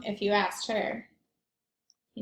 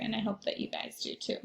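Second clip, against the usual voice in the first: whisper; 8 dB quieter than usual. Real, not acted. Soft unvoiced aside.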